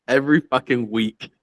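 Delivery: ordinary talk, nothing marked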